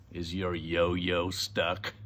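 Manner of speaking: slow, low policeman voice; low and drawn-out; policeman character. Acted. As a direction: drawl it as a doubting policeman. Policeman teases woman in story.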